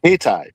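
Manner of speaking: second syllable of Hayti rhymes with tie; Hayti said hey-tie. Shown as a demonstration. Correcting the listener's guess at the town's name, Hayti pronounced hey-tie. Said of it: "but it's pronounced Hayti".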